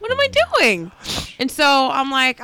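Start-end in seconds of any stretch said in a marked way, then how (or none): 0.0-0.9 high-pitched wail of a question; exasperated self-questioning. Retold or imitated. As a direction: wail it at yourself in mock despair. Repeats self-question as exasperated cry.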